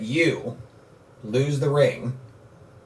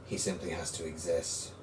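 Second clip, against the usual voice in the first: a calm dark lord judging the hero; sinister villain. Acted. GM voices villain at length.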